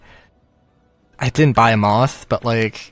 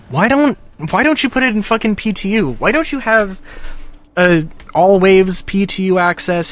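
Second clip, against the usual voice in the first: higher and fast, fed up; exasperated rant; 5 semitones higher than usual. Real, not acted. Frustrated fan ranting at the developers, pitch up and fast. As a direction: fire the questions off in frustration.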